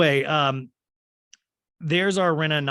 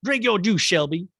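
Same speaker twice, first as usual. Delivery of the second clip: higher and louder, a mother nagging in a put-on voice; hammed-up film mom line, higher and louder; Sally Field quote; 7 semitones higher, 6 dB louder than usual. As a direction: mom urging her daughter to drink up, loud and high. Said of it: "Sally Field's like".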